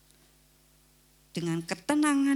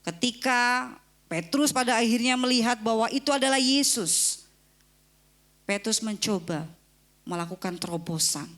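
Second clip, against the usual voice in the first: usual speaking voice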